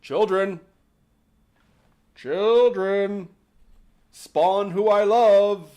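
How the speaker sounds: put-on dad calls for his lost kids; calling out. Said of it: "calling for them like".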